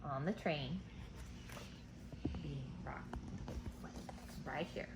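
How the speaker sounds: gentle hush. Real, not acted. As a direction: lull the class as the train rocks her. Soft lull, rocking to sleep.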